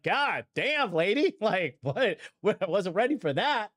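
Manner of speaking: high, laughing disbelief; amused shock. Retold or imitated. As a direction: laugh and pitch up in mock astonishment. Retells his startled amused reaction.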